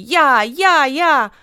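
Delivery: loud eager shout of me me me; eager calling out. A narrator's reading. Whole family shouting to mum that they want to swim, eager and loud. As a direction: call out like kids begging to go first. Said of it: "A všichni volali".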